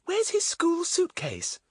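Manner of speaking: put-on very high, slow child voice; little girl. A narrator's reading. Betts pulling Pip back, doubtful high child voice. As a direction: ask it in a small high-pitched voice. Young Betts questions the others.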